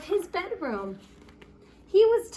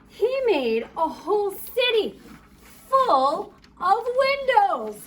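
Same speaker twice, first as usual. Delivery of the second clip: higher, louder, slowing on full of windows; emphatic storybook build; 6 semitones higher, 5 dB louder than usual. A narrator's reading. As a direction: marvel at every window. Building wonder at the city.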